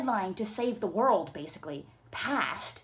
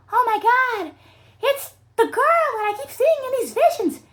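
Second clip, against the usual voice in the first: excited gasp; 10 dB louder than usual. Acted.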